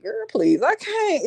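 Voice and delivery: put-on high, laughing "girl, please"; laughing refusal. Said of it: "I was like".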